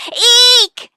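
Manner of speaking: high, hammed-up shriek; mock death shriek. Princess on cue, a hammed-up high shriek. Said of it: "Drop dead".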